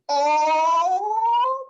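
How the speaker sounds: long, high, loud "ooh"; Lady Pomp's scandalised "Oh!". Acted. Lady Pomp hears Madame Scowl get burned, long high "oh". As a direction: hold a high theatrical gasp of "oh". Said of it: "Lady Pomp goes".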